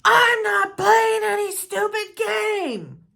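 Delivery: father slamming his Bible shut, refusing to play; defiant angry dad. Retold or imitated. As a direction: bang out each word like the furious father. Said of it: "He slams his Bible shut and he says".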